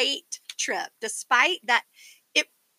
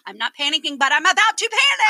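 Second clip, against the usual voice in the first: mock panic; 2 semitones higher, 7 dB louder than usual. Sister quoting her panic again, building louder. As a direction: ham up the panic on the last words. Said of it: "They stuck".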